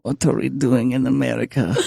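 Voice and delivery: deep mock-Russian lament; Russian-accented voice. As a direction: imitate a gloomy Russian man, low. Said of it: "The way you're talking in that voice is like Red".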